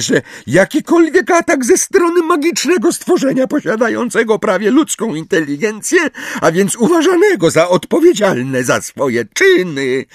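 high, squeaky officious recital; Umbridge. A narrator's reading. Umbridge quotes regulations at the centaurs, squeaky. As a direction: recite the rules in a piercing high voice. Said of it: "ciągnęła dalej".

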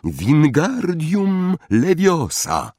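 high, slow, drawn-out incantation; spell incantation. A narrator's reading. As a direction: cast the spell, stretching each syllable. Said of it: "wykrzyknął pierwsze zaklęcie". (Ron rzuca zaklęcie, powoli i wysoko)

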